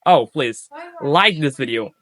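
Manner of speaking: ordinary talk, nothing marked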